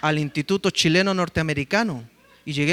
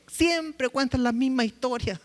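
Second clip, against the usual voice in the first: wife's mild complaint; 6 semitones higher, 3 dB quieter than usual. Retold or imitated. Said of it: "mi esposa me dijo".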